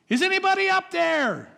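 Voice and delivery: high, loud panicked call upward; a desperate kid shouting up the cliff; kid yelling for help. Retold or imitated. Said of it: "he starts to yell".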